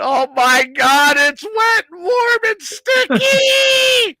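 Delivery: imitating a shriek that climbs very high; hammed-up scream, stretching the last word; horrified yelling. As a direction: yell in disgust, building to a long high screech. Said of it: "she started yelling again".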